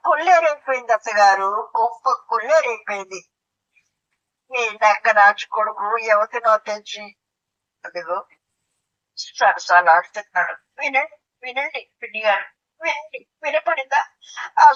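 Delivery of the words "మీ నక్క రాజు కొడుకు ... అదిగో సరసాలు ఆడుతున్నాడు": usual speaking voice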